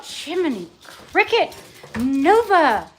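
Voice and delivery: loud, high exasperated yell, slowing at the end; exasperated shout. Real, not acted. Cook interrupted by barking dog, exasperated shouting. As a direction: shout at the dog, drawing out the last command.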